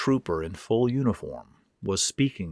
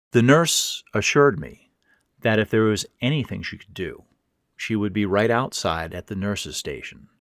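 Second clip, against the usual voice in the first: plain reading voice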